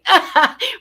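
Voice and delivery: quick loud burst of laughter; short laugh. Real, not acted. Speaker laughing after mocking caregivers, loud laugh. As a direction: laugh at her own impression.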